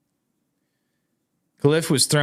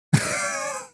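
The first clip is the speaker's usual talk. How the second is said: a high, squealing burst of disbelieving laughter; piercing laugh of disbelief; shrieking laugh; 4 dB quieter than usual. Real, not acted. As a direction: let a sudden shriek of laughter escape. Outburst of disbelief.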